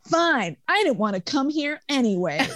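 high whiny pouting voice; sulky whiny retort. Retold or imitated. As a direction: whine it like a sulky kid. Sulky drunk man retort.

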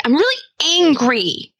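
put-on a light child's voice saying she's angry; little daughter's voice. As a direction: play the five-year-old announcing her anger. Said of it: "she'll actually say".

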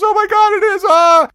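very high, loud horrified scream; horrified shriek. Retold or imitated. Girlfriend sees her face in the mirror, horrified high scream. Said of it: "she goes".

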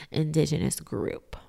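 usual speaking voice

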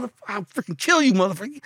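high-pitched heated threat; acted threat. Man grabbing a crowbar, high-pitched acted threat. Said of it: "I threatened to kill him".

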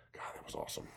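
breathy hushed aside; whispered awe. Real, not acted. Remembering the game, a hushed whisper.

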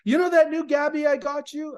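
high, loud, upset retelling; urgent imitation of someone in tears; bawling. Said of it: "she's bawling".

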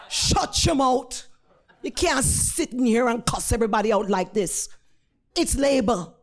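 forceful scolding in thick Jamaican; stern Jamaican-accented telling-off. Retold or imitated. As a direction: scold like a no-nonsense Jamaican nurse. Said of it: "in a thick Jamaican accent".

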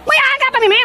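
mock indignation. Caller complains about being hung up on.

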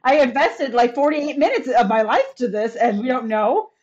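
loud, high indignant rant; indignant outburst. Retold or imitated. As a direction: protest at the lost time, loud and high. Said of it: "and I'm like".

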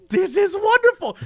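high-pitched gleeful wonder; mock delight. Onlooker enjoying a man's first espresso, gleeful mock delight. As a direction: gush it way up high.

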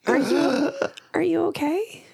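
high, hushed, hesitant little voice; Saya. Acted. Timid attendant checking on a fallen traveller, high hushed voice. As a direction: check on them in a high, breathy near-whisper. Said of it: "I did Saya's voice".

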